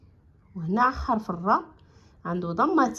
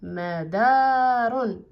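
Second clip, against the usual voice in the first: مدار read very slowly as madarun, a little louder; slow drawn-out word; 4 dB louder, much slower than usual. Shown as a demonstration. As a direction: stretch مدار out with its ending. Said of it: "غنقراوه مدار".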